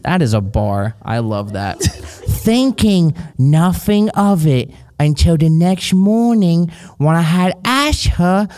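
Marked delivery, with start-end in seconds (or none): 1.8-8.5 put-on slow, silly British accent climbing into a high pitch; hammed-up babyish Brit, drawing out the words. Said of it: "Yours is like a baby Brit".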